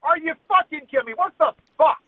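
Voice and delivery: high, incredulous outburst; outraged disbelief. Real, not acted. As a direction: spit it out in furious disbelief. Furious at police inaction.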